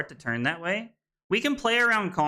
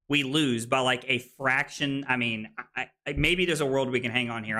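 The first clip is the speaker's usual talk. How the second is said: normal talking voice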